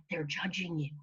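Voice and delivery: low, soft inner bully; self-talk bully. Acted. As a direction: lean in quietly like the voice of doubt. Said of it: "Your self-talk voice said".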